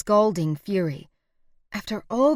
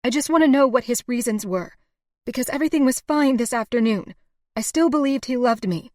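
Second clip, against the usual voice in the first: loud, high pleading; raised voice, pleading hurt; distressed; 6 semitones higher, 6 dB louder than usual. A narrator's reading.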